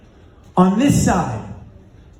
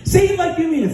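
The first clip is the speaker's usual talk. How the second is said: higher-pitched demand for conviction; insistent urging; 6 semitones higher, faster than usual. Retold or imitated. Running partner urges his exhausted friend to repeat it, insistent. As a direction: push him to believe it, pitched up.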